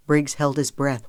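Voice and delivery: ordinary delivery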